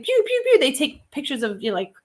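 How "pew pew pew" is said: high playful pew sounds, much higher; kids' camera noise. Retold or imitated. Mimicking kids snapping phone pictures, high playful pew. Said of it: "they're like".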